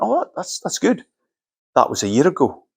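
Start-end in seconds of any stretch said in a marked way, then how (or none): 0.0-1.1 caught off guard. Retold or imitated. Halting reply to news.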